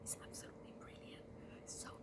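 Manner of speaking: very soft church whisper; whispered gratitude. Acted. Cat to Rat during the service, whispering. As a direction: whisper thanks without disturbing the service.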